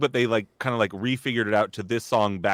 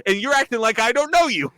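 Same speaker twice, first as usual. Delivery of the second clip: indignant complaint; 9 semitones higher, 4 dB louder than usual. Retold or imitated. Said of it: "I was like".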